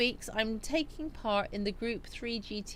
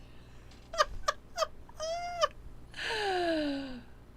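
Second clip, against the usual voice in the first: long, high helpless laugh; laughing at herself; 13 semitones higher than usual. Real, not acted. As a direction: crack yourself up in a high peal. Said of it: "I'm making myself laugh".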